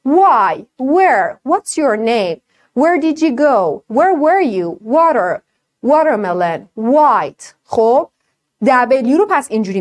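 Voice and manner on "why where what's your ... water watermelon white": "Where" and "Water" with lips rounded; short W drill words, clearly. Shown as a demonstration. (تمرین تلفظ دبلیو)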